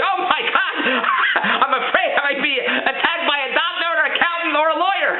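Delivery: imitating wife afraid of rich people; mock fear. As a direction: ham up the wife's fear through laughter. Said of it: "she's telling me".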